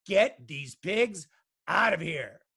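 slow, loud, measured bellow; father's yell. A narrator's reading. Father wants pigs out of the kitchen, slow loud bellow. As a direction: order it word by word, loudly. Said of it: "yelled her father".

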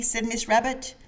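plain reading voice

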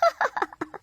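short high scornful laugh; a quick derisive giggle; mocking laugh. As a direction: laugh him off. Wife laughs at husband's joke.

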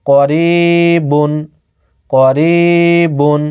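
করিবুন stretched very slowly, each sound held long; teacher's slow model pronunciation; slow drawn-out recitation. Shown as a demonstration. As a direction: chant করিবুন syllable by syllable, slowly. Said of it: "একবার উচ্চারণ করে".

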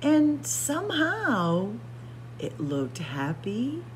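plain reading voice

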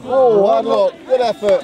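sideline shout. Real, not acted. Sideline cheering at a match.

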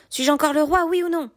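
high-pitched, vehement royal outburst; indignant decree, held high throughout; crying out. A narrator's reading. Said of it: "m'écriai-je".

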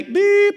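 high, loud imitated intercom beep; mimicking an intercom tone; mock intercom beep. Imitating office intercom buzz.

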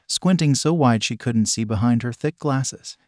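plain reading voice

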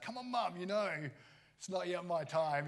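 a grown son mock-whining to his mum; playful son. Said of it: "he's like".

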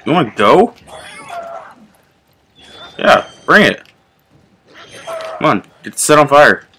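loud, high, fired-up taunt; excited taunting. Real, not acted. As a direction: egg them on, pumped. Taunting wolves in fight.

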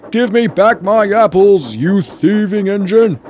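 high, loud, slow furious shout; outraged yelling character voice; irate vicar. A narrator's reading. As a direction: bellow after a thief, drawing out each word. Angry vicar yells at thieving engine.

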